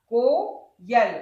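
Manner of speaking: कोयल slowly broken as को, यल; syllable by syllable. Shown as a demonstration. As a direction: hold को long, then add यल. Teacher sounds out vowel sign.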